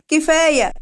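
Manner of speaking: put-on short sharp shout at the children. A narrator's reading. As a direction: yell it to make the kids freeze. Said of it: "راحت صرخت فيهم".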